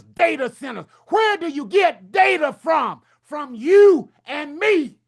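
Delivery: fiery revival-style shouting about A I; preaching shout. Real, not acted. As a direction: hammer each line to the crowd. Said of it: "what did he been drinking".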